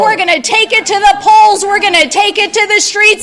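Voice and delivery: rising rally cry, building to a shout; rally speech. Real, not acted. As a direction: fire up the crowd.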